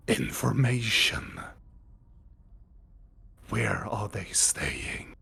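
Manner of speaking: slow, low, whispered eerie voice; disguised deep voice. Acted. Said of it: "very distorted voice of different types of voices of men, women, and children".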